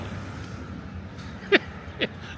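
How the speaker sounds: soft, high chuckle; laugh. Real, not acted. Laughing after the shrimp on the barbie joke, soft chuckle. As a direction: chuckle quietly at your own joke.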